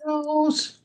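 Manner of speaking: squeaky falsetto house; high squeaky echo. Retold or imitated. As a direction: pitch it way up in falsetto. Echoes the hook line.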